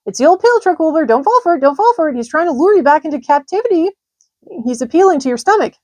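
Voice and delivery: very high, loud honking goose cries; a goose barking orders to the pig; shrill-pitched urgent goose shouts; excited goose. A narrator's reading. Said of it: "said the goose".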